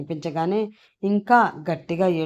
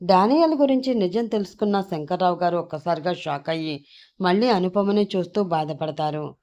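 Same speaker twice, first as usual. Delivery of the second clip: ordinary delivery, nothing marked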